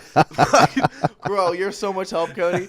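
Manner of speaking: high, loud mock-sarcastic whine; high laughing sarcasm; sarcastic retort. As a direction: throw a mocking "thanks for nothing" at him, laughing. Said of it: "I was like".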